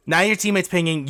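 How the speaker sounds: normal talking voice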